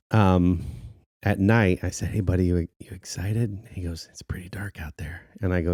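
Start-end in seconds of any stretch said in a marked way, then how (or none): 2.1-3.6 soft unvoiced whisper to a child. Dad whispering to son at night. 4.1-5.2 put-on much softer, breathy little-kid whisper; child's whisper. Son's worried reply, whispered.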